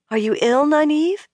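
high, rising worried question; anxious. A narrator's reading. Woman checking on a sagging friend, anxiously. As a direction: ask anxiously, voice lifting sharply on the name. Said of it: "she asked anxiously".

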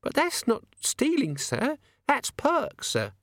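high, slow insistent maid voice; indignant maid. Acted. Maid insists, higher still.

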